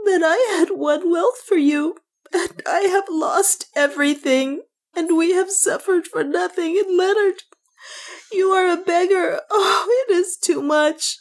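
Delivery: high, tearful lament; wailing. A narrator's reading. Juana laments the lost wealth to Leonard, high tearful wail. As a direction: cry it out high, overwhelmed with despair. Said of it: "she wailed".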